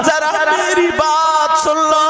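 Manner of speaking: loud, chanted sermon storytelling; preaching at full force. Acted. Preacher to the congregation, telling the Prophet's story, fervent chanting preaching. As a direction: preach it with swelling fervour, half sung. Impassioned sermon over music.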